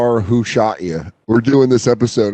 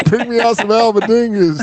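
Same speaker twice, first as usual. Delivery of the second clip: higher, hammed-up customer order; mock demanding customer; 9 semitones higher than usual. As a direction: ham up the diner barking an order. Repeats the order in a voice.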